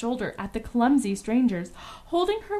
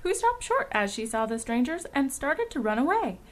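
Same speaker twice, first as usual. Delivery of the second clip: usual speaking voice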